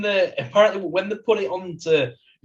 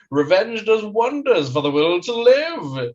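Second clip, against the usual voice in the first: slow, high, plummy villain line; mock posh British baddie; 5 semitones higher, 3 dB louder, slower than usual. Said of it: "the way he says it when he goes like".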